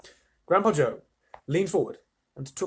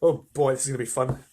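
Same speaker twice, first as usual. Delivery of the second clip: plain reading voice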